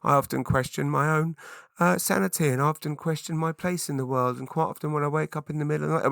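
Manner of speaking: mock over-sharer pouring out self-doubt; Debbie Downer. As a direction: play a hammed-up downer. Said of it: "don't wanna be Debbie Downer".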